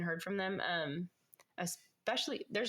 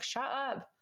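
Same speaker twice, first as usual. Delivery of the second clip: higher-pitched retold "shut up"; frustrated; 6 semitones higher than usual. Retelling the roommate opening her door, mock frustration. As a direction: mimic someone fed up with the noise. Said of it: "was frustrated and opened it".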